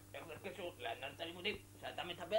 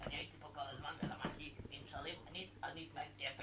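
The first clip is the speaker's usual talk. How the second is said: unvoiced hurried whisper; hushed whispered excuses; 7 dB quieter than usual. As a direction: whisper so the teacher won't hear. Whispering excuses before being caught.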